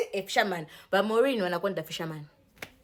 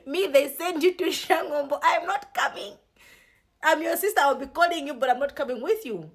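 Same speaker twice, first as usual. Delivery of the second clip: a higher, put-on voice for the refusing sister; mocking refusal; 7 semitones higher than usual. Sister turns down the trip in a raised mock voice. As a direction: play the sister who won't go, pitched high.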